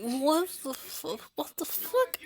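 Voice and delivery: high, rising startled swear; a startled cry as pans crash down; shocked what the fuck. Retold or imitated. Startled by crashing pans, a high shocked swear.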